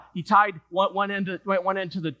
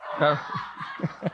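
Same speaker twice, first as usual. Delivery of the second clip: unvoiced chuckling after the punchline; laugh; 4 semitones lower, 4 dB quieter than usual. Real, not acted. Preacher tops a grim story ending, real chuckle. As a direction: laugh softly at your own story.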